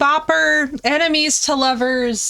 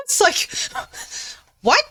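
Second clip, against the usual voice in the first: laughing disbelief; 5 semitones higher than usual. Real, not acted.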